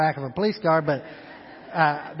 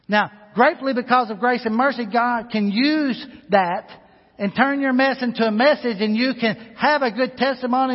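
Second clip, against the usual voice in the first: louder and higher, rising preacher's fervor; full-force preaching; 6 semitones higher, 5 dB louder than usual. Acted. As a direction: preach it out to the whole church.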